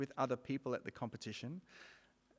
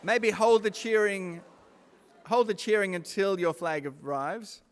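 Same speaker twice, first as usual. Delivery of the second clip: loud, higher voice over the cheering; calling over crowd; 6 semitones higher, 11 dB louder than usual. Real, not acted. Host asks cheering students to wait for their flag, loud call. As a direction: hold them back with a raised call.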